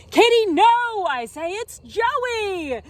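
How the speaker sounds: high, loud cry slowing down; frantic warning. Acted. Child warning his cat sister off Joey, frantic. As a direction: call out to stop the cat.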